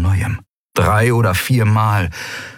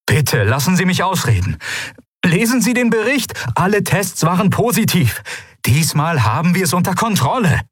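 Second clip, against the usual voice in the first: much higher, urgent pleading voice; pleading aide; 10 semitones higher than usual. A narrator's reading. Aide begs his ruler to read the report, pleading. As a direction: beg her to hear him out.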